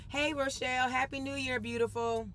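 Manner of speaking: high, bright hello to a viewer; cheerful greeting. Real, not acted. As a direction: sing out a greeting.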